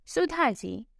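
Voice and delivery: ordinary delivery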